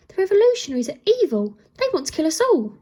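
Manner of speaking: high, loud, fast outburst; boy's exclamation. A narrator's reading. Prince horrified at his mother, loud high exclamation. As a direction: blurt it out in shock at his mother. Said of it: "he exclaimed".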